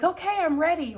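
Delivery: high, loud, keen learner; eager acted readiness. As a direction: announce it like a kid about to ride. Plays nervous child on bike.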